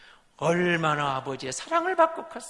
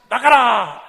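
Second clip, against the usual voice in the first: command; 2 semitones higher, 10 dB louder, much slower than usual. Acted. Joseph ordering everyone out.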